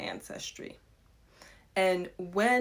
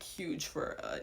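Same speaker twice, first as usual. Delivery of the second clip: normal talking voice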